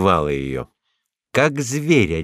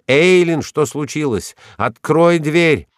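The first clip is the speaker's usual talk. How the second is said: raised, urgent shout through a door; 7 semitones higher, 4 dB louder than usual. A narrator's reading. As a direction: demand loudly, pitch pushed up. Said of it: "закричал он". (тревожный крик)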